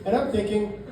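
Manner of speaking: ordinary talk, nothing marked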